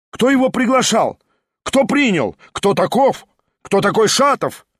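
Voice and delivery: agitated crowd; rapid shouted questions. A narrator's reading. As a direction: pelt him with questions. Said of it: "сыпались вопросы".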